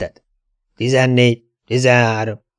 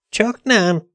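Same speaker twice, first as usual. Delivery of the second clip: mocking echo; 10 semitones higher than usual. Fante echoes Dante's question, high and mocking.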